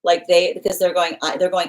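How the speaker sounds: ordinary talk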